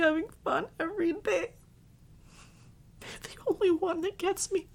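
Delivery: high tearful pleading, breath catching; weepy voice, softening toward the end; crying. Acted. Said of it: "Why am I crying?".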